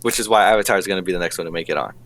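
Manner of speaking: rushed, confident verdict; quick, low-pitched, assured delivery; fast and confident. Real, not acted. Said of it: "You said that really fast".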